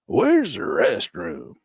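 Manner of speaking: high, slow spaced-out hippie; stoner customer. Retold or imitated. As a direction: ask for candy like a stoned customer. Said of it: "Guys come".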